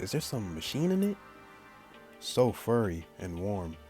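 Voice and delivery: usual speaking voice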